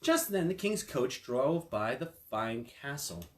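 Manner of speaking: ordinary delivery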